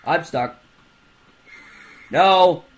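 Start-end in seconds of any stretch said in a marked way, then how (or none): 2.1-2.6 sharp high yell of protest; loud no. Real, not acted. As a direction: shout it out in panic. Getting caught in game.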